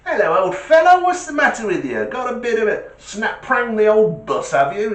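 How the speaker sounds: high, loud, hearty greeting; cheery Porsche driver. Retold or imitated. Porsche driver pulling up beside the old man, high and hearty. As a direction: hail the old boy like a jolly toff. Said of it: "this bloke says".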